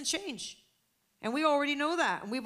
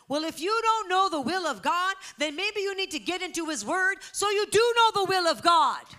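high, loud pulpit rebuke; raised-voice preacher's challenge; full-force preaching; 8 semitones higher, 8 dB louder than usual. Acted. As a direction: build to a ringing, forceful finish.

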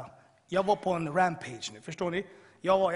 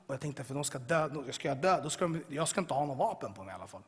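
ordinary talk

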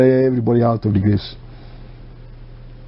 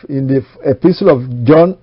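ordinary talk

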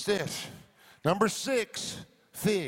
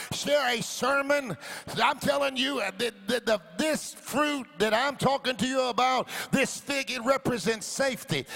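forceful preaching; 6 semitones higher than usual. Real, not acted. Pastor urging people to share worship and sermons, forceful preaching. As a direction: urge the church on with conviction. Preaching rises after calm talk.

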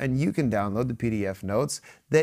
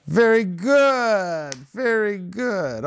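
drawn-out, higher, louder praise; 7 semitones higher, 3 dB louder, much slower than usual. Real, not acted. Father praising his son's answers, drawn out.

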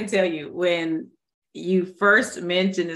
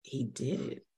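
hushed awe; 5 semitones lower, 15 dB quieter than usual. Retold or imitated. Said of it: "And I was like".

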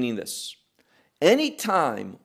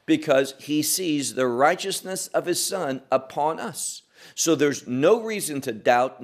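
ordinary talk

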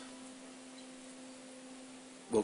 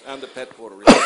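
loud laugh then blurted joke; 4 semitones higher, 19 dB louder, faster than usual. Real, not acted. Bob jokes to the church about himself, loud laughing. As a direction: burst it out laughing, much louder. Joking to the congregation.

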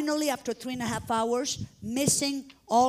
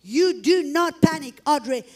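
firm, raised-voice self-command; retold emphatic self-talk; talking out loud; 3 semitones higher, 7 dB louder than usual. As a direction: order yourself to stay calm, word by word. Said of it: "talking out loud to myself".

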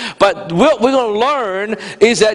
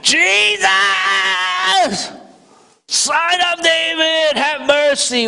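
high, slow desperate cry to Jesus; crying out louder; 9 semitones higher, slower than usual. Retold or imitated. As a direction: plead at full force, stretched out. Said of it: "he cried out all the more".

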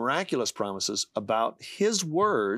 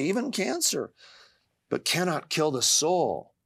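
scripture recitation; 4 semitones higher than usual. Acted. Jesus' words to a worried father, pleading scripture recitation. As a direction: urge with climbing pitch, then ease down.

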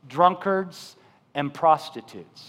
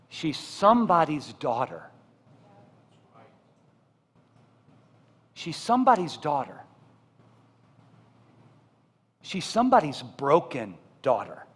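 tender repetition; 4 semitones lower, 4 dB quieter than usual. Acted. Pastor softly reminding the church who she is. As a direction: plead gently, letting each line sink in. Tender plea about prostitutes.

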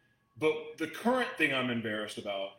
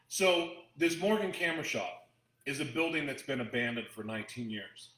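normal talking voice

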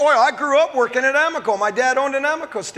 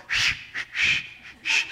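soft unvoiced shushing; calm them down with a shush; hushing shh; 7 dB quieter than usual. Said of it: "And Jesus is going".

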